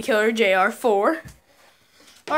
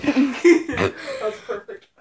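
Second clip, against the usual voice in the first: laughing; 3 semitones higher, slower than usual. Real, not acted. Laughing after the burp alphabet.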